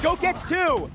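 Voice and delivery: high, loud shout; excited play-by-play. Real, not acted. Caster calling Goat's clutch kills, excited shout. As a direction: call the double kill at full voice.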